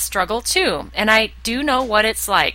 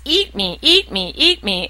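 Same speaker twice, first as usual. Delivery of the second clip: lightly voiced candy, beckoning; a hint of a tempting call, repeated; 3 semitones higher than usual. Bag of candy tempts the dieter from the counter, lightly calling. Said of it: "it calls to you".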